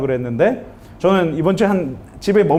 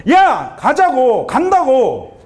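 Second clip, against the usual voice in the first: drawn-out, higher-pitched exasperated yell; retold angry outburst; 5 semitones higher, much slower than usual. Father snapping at his pestering son, angry outburst. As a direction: act out a dad blowing up. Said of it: "폭발했어요".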